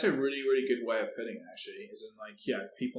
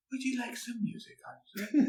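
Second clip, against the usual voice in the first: high synthetic assistant voice; sing-song AI voice offering music; computer voice; 11 semitones higher than usual. Shown as a demonstration. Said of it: "this is my computer voice".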